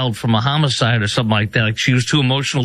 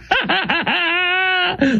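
long, high-pitched burst of laughter; high cackling laugh. Real, not acted. Host cracking up at the congresswoman's clip, high cackle. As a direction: cackle helplessly, high and sustained.

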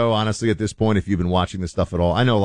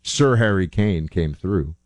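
normal talking voice

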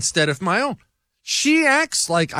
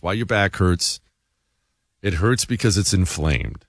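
lower-pitched, measured sales pitch; ad read; 10 semitones lower than usual. Acted. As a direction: sell it as a radio sponsor read. Reads a sponsor ad.

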